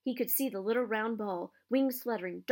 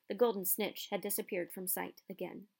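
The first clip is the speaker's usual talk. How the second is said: ordinary delivery, nothing marked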